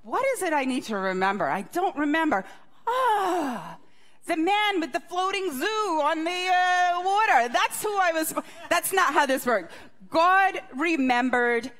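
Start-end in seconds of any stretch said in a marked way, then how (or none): 0.0-8.3 hammed-up forgetful muttering rising to a sudden realization; mock absent-minded, then an exaggerated "aha"; forgetful God. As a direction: play God racking his brain, then higher as it dawns. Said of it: "God was not spending his day ... and saying".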